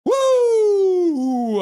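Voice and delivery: long, loud high whoop; high "woo" sliding down at the end; cheering whoop. Real, not acted. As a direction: let out a piercing celebratory whoop. Cheering gifted memberships.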